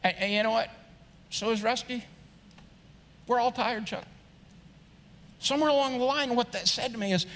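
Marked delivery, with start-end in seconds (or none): 0.0-4.0 softer, unhurried reply with pauses; buddy-to-buddy. Acted. Kirk gently setting his tired friend Chuck straight, buddy-to-buddy. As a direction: reason with him quietly, as a pal. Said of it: "Maybe he says it like this".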